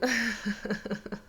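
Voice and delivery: short embarrassed laugh at herself. Real, not acted. Psychic laughing at her own full-moon excuse, short embarrassed laugh.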